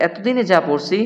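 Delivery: ordinary talk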